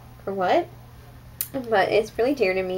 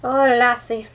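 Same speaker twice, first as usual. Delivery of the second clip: put-on louder, higher joke Irish accent; mock Irish accent; 4 semitones higher, 6 dB louder, slower than usual. Vlogger kidding about her accent slip, in mock Irish. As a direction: put on a playful Irish brogue. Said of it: "or Irish".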